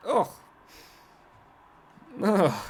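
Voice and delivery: a real groan of disgust at the bright screen. Real, not acted. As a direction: groan like something smells bad. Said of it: "It's disgusting".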